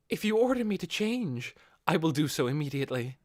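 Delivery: a devoted wife confessing her slip; high Albedo voice. Acted. Albedo confesses her etiquette error to Ainz, earnest and high.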